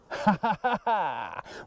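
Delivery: long laugh falling away; delighted laugh. Real, not acted. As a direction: laugh with glee at the catch. Lands a small bass.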